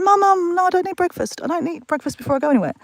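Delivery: high-pitched, hurried protest to Mum; acted protest. Daughter refusing breakfast from Mum, acted high protest.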